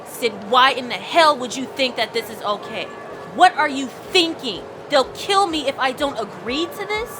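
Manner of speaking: panicked yell. Acted. Dakota yells at Sid for dragging her into this. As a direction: let the panic burst out at her. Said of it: "I yell them out at her".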